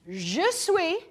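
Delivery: "Je suis" said softly in French, lingering on "suis"; French apology. Shown as a demonstration. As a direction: start a heartfelt French apology. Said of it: "But French is different".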